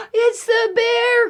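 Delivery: put-on high, loud panicked child; frightened cry. Eddie spotting the bear, high frightened cry. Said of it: "cried Eddie".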